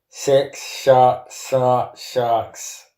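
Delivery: mangled words, tongue pinched; holding tongue with fingers. Real, not acted. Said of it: "I'll start again".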